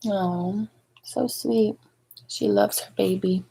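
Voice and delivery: hushed, tender comment; soft aside. Real, not acted. Reader softly telling the child how sweet the mother is. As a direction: gently share the moment with the listener. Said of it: "reader's soft aside to child".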